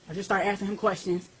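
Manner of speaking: ordinary talk, nothing marked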